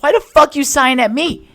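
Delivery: high, loud furious shouting; hammed-up screaming outrage; livid. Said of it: "I was fucking pissed. I was livid".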